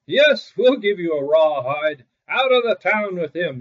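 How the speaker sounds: loud, jeering yell; angry shout. A narrator's reading. Tanners shouting at Great Claus as they beat him, angry. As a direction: drive him out of town. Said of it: "they shouted".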